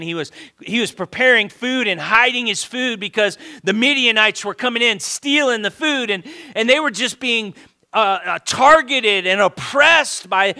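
high-energy pulpit retelling; animated preaching. Real, not acted. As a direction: tell the story with mounting urgency, stumbling over words. Excited retelling at full force.

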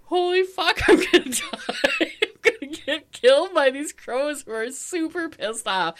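high-pitched mock panic, half laughing; retold panic. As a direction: exclaim in laughing alarm. Retold panic during crow attack.

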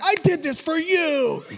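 high, pleading, half laughing; a frazzled father pleading with a laugh; pleading dad. Retold or imitated. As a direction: beg the whining kids to enjoy it. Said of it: "I'm like".

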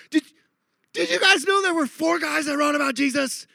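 high, hammed-up amazement; giddy, high-pitched mock zeal; overexcited new convert. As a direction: blurt it out like astonishing news. Retold gushing of new convert.

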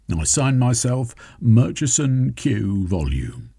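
a different, stiff formal voice, grudging; Murchison reading his confession. Acted. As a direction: recite the confession through clenched pride.

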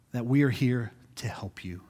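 soft breathy whisper to the parents; hushed reassurance. Real, not acted. Pastor whispers to parents that the team is here. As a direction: lean in and whisper the promise.

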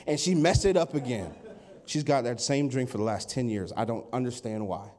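low, muttered-style petty gripe; mock complaining. Preacher continues the barista gripe, mock complaining. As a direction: grumble in a low voice about a small slight. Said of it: "that's complaining".

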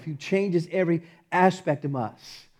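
normal talking voice

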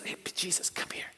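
whispered mock beckoning; hushed aside. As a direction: pull someone aside in an acted whisper. Peter pulls Jesus aside.

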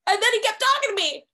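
high, quick excited burst; giddy amazement. Real, not acted. Fan telling how the star kept talking, giddy and high-pitched. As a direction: blurt it out high and fast in disbelief.

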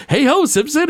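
cheery high goody-goody hello; Flanders impression. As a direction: pitch it way up like an overly friendly neighbor. Flanders greets Homer in story.

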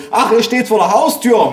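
high-pitched mother shouting down the line; calling into phone. A narrator's reading. Mother realising where the grandparents are, calling out high. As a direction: loudly repeat it for deaf old parents. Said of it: "rief meine Mutter ans Telefon".

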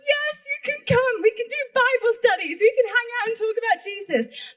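very high, gushing invitation; thrilled acceptance, starting stretched and high; overjoyed retold yes. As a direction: squeal the offers out in delight. Retold delighted yes to friend.

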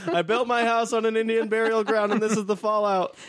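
high-pitched joking complaint; high mock voice. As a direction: squeak it out in mock despair. Joking cursed-person quote.

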